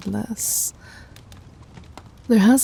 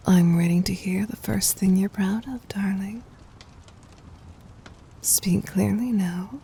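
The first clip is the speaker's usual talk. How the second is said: whisper; 3 dB quieter than usual. Acted. Tender intimate command.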